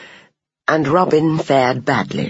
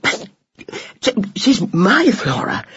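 higher, broken panting protest; breathless, struggling objection; 5 semitones higher than usual. A narrator's reading. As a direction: stammer it out of breath. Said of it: "panted Robin".

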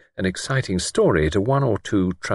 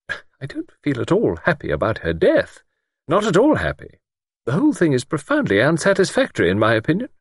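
high-pitched, unhappy lady's voice; troubled older lady; 9 semitones higher than usual. A narrator's reading. Miss Wainfleet uneasy about the girl's death, troubled.

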